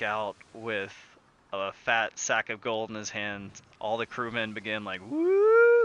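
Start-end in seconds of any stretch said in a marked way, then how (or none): 5.1-5.8 high, loud crowd cheer; cheering whoop. Retold or imitated. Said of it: "like, cheering".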